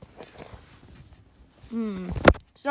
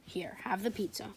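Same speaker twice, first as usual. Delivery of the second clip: whisper; 10 dB quieter than usual. Acted. Giving pizza quietly.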